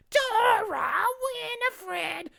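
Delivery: high, slow, drawn-out witch voice. Retold or imitated. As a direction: stretch it out like a cackling witch. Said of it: "a bit of the witch voice".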